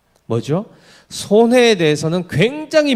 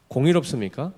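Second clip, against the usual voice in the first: ordinary delivery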